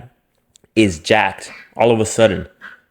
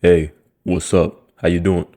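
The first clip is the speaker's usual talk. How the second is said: fake smooth voice; 6 semitones lower than usual. Guy greeting a girl on Xbox, low mock-suave. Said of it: "you don't even talk like that".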